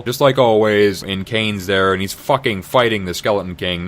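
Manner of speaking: ordinary delivery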